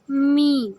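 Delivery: loud, drawn-out humming "میم"; gently closed Meem. Shown as a demonstration. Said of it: "ہونٹوں کو نرمی سے ملاتے ہوئے". ("میم" ہونٹ نرمی سے ملا کر)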